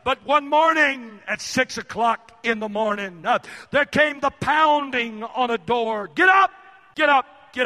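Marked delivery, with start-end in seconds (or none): 6.2-7.7 loud, high shout of get up; a father yelling his sons out of bed; father's wake-up shout. Retold or imitated. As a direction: bang on the door and roar them awake. Father pounding on door waking boys.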